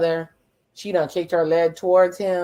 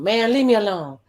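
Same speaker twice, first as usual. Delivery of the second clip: exasperated retold brush-off; 5 semitones higher, 4 dB louder than usual. Recapper quotes a man wanting to be left alone, exasperated. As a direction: imitate him begging off, pitch lifted.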